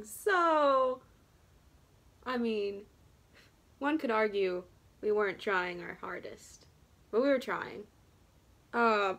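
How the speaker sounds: talking while applying face mask; speaking behind held packet. Real, not acted. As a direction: voice slightly blocked by packet. Seen 0: Hand on forehead; mask packet held over mouth area while applying.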